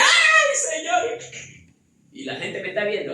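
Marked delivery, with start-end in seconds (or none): 0.0-1.2 loud, high mock wail of an overexcited believer; mock emotional cry. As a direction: ham up a gushing churchgoer crying out. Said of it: "Y grita". (grito exagerado de creyente emocionado)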